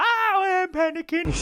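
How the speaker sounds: high, loud cartoon panic voice; panicking stomach. Voicing his stomach freaking out, high panicked squeal. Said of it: "your stomach's just like".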